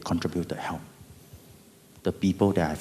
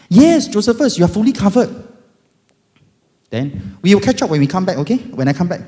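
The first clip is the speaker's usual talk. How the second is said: much higher, loud chatty agent; insurance agent; 11 semitones higher, 10 dB louder than usual. Retold or imitated. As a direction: rattle off good news brightly. Agent voiced again.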